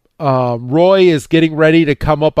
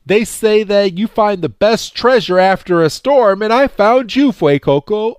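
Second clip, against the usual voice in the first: sweet Roy voice; 8 semitones higher than usual. Retold or imitated. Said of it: "Roy's like".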